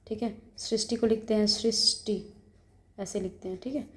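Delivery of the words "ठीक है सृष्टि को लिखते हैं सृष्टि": ordinary talk, nothing marked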